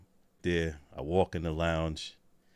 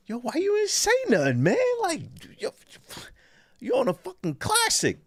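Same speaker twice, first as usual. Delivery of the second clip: much higher, excited mock voice; urgent friend. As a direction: call him out in a high squeal. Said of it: "you go".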